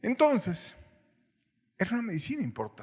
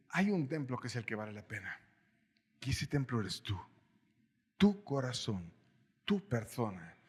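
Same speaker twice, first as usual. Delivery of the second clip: hushed, lowered voice quoting Paul; quiet solemn quote; 5 semitones lower, 6 dB quieter than usual. Retold or imitated. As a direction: lower and softer, reverent reading.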